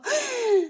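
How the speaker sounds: sharp high-pitched acted gasp; sudden shrill intake. A narrator's reading. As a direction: let out a high, shocked gasp. Sound after the blow to mother-in-law.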